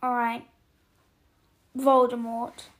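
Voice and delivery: blurted loud, bracing for it; forced out. A narrator's reading. Hagrid makes himself say the dreaded name to Harry.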